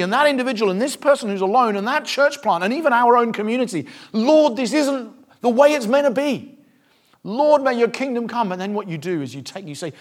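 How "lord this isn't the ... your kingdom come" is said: higher, louder earnest plea; pleading prayer. Acted. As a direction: beg the Lord for his kingdom. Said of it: "You cry for the situation".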